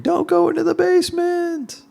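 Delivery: screaming at the screen. Retold or imitated. Moviegoer yelling at the character on screen, screaming. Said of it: "you start screaming at the screen".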